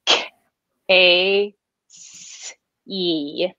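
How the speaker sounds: K, A, S, E split into separate sounds with pauses between; spelled sounds. Shown as a demonstration. Teaching the sounds of her name, one at a time. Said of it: "I have a hard C".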